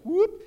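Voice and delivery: high, loud whoop noise; sudden whoop. Retold or imitated. Preacher mimicking a runaway inner tube, high whoop. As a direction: imitate something shooting off with a whoop. Imitates tube shooting away.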